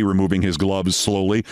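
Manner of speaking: plain reading voice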